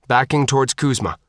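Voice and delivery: plain reading voice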